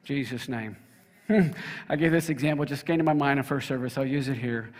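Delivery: usual speaking voice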